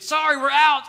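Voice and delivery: loud high call to the crowd; frazzled announcement. Retold or imitated. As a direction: shout it over a mob of shoppers.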